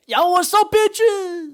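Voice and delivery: very high, loud little-man voice; hammed-up high-pitched cocky greeting; tiny man greeting. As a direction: shout hello in a squeaky voice.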